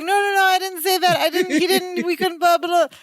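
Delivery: high-pitched mock fluster; flustered bumbling. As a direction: imitate panicked denials falling apart into babble. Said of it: "just as he's bumbling".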